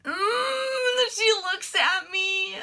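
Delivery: high, drawn-out mock-crying squeal; mock teary whine. Fan retelling how she fought back tears, mock teary whine. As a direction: whimper it slowly in a high, put-on sob. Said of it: "struggling to keep my tears".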